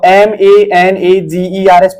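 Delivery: slow, letter by letter; slow spelling demonstration; spelled letter by letter. Shown as a demonstration. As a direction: read each letter on its own. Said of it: "पढ़ोगे".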